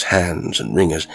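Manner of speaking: ordinary delivery, nothing marked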